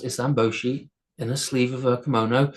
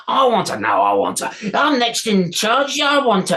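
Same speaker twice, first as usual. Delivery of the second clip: higher, louder greedy voices; squabbling trolls; 8 semitones higher, 4 dB louder than usual. A narrator's reading. Trolls fighting over the sleeping girl, high greedy squabble. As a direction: grab for what they want. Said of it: "trolls squabbling over the girl".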